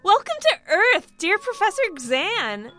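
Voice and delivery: very high, very loud gushing welcome; shrieking excited hostess; gushing exclamation. A narrator's reading. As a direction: exclaim it with giddy delight. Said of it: "she exclaimed".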